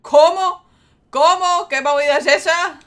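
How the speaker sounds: loud, high, disbelieving shout; shocked yell. Real, not acted. Shocked by game message.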